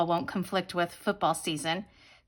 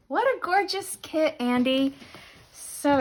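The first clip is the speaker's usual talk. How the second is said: excited; 6 semitones higher, 4 dB louder than usual. Real, not acted. Admiring new planner kit.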